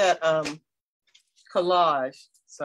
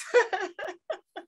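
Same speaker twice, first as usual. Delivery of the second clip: short high nervous laugh; 12 semitones higher than usual. Real, not acted. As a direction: laugh off the nerves. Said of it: "I'm nervous".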